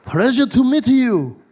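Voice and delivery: a hint of a Japanese accent, slow; gently imitated Japanese accent; mock Japanese accent. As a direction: greet politely in a light Japanese accent. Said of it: "He's like".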